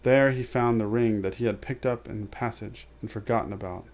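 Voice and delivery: usual speaking voice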